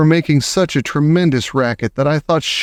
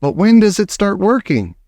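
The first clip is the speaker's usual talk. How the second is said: higher, eager witch cry; crying out eagerly; excited witch audience; eager witches; 8 semitones higher than usual. Acted.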